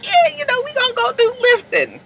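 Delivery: high put-on girly voice; high mock Issa. Retelling Issa to the audience, high mock girly voice. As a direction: squeak it out like a giddy girl.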